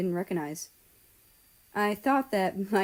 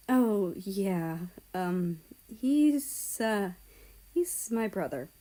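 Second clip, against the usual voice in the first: hesitant; slower than usual. Acted. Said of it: "a little hesitant".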